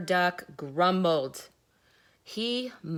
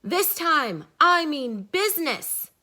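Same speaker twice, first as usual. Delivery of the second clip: stern shout; 8 semitones higher, 9 dB louder than usual. Acted. Duck still scolding the animals.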